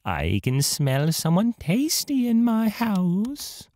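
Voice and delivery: high, slow hungry witch voice; high squeaky witch. Acted. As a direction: sniff out the tasty guest like a greedy hag. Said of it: "a high, squeaky voice say".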